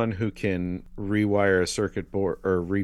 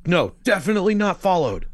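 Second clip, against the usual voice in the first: high, louder nervous denial; 11 semitones higher, 6 dB louder than usual. Retold or imitated. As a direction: squeak a guilty no. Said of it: "He's like".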